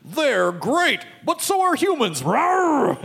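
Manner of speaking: high, then a roar; roaring tiger. Retold or imitated. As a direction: mascot turning savage. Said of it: "what if Tony the Tiger was like".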